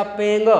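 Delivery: पेंग ending in a nasal "ng"; said through nose. Shown as a demonstration. Teacher shows misplaced-dot reading, nasal पेंग. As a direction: let पेंग close in the nose. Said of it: "अब नाक से उच्चारण हो रहा है".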